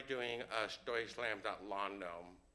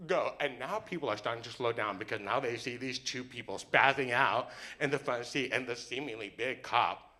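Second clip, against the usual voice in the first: usual speaking voice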